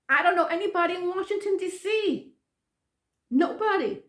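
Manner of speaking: high, quick insistent protest; re-enacted exasperation at the question; exasperated denial. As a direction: deny it emphatically, pitched high.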